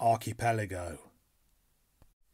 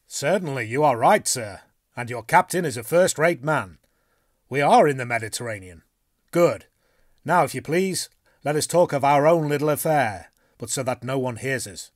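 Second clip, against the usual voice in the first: a blunt harpooner, eager to plot; bluff Canadian harpooner; 3 semitones higher, 8 dB louder than usual. Acted. Harpooner urges his companions to talk, hearty and loud.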